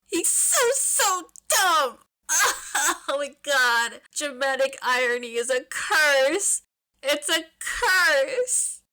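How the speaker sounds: high, teary laughing whine; half-laugh, half-sob in fond despair; laughing-crying meltdown. Real, not acted. Fan reader losing it over dramatic irony, laughing through mock tears.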